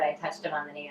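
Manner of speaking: usual speaking voice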